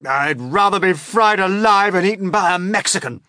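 high, loud wail of dread; old grasshopper wailing. A narrator's reading. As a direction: wail it out in despair. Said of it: "wailed the old green grasshopper".

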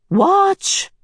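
high, bright-eyed seer's call; the Happy Medium. Acted. The Medium urging the children, high and eager. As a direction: cry out like an excited seer.

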